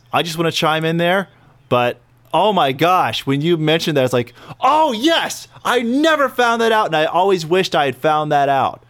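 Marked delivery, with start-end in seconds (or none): 4.6-8.8 high, gushing fan voice; mock-thrilled fan, pitched way up; gushing quote. Excited fan quote acted out.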